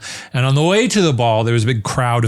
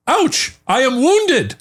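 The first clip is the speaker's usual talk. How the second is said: high, slow hammy proclamation; proclaiming king; 14 semitones higher, much slower than usual. Retold or imitated. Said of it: "he proclaimed".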